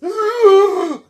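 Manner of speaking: a big, high theatrical gasp from the audience; a viewer in the audience gasping at the reveal; loud shocked gasp. Retold or imitated. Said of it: "me in the audience was like".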